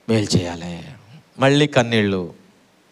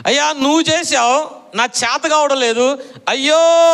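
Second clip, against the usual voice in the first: higher, lament-like cry to the Lord; anguished cry; 8 semitones higher than usual. Retold or imitated. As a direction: plead to the Lord in pain. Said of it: "ఏంటా కేక?". (ప్రభువుకు మొరపెడుతూ బాధతో అరిచే భార్య, ఎత్తైన స్వరంలో)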